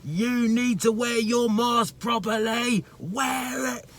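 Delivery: high, slow sneering voice mocking the bossy man; mocking the customer. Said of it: "My man has his mask like this".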